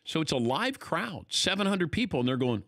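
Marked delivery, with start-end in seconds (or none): none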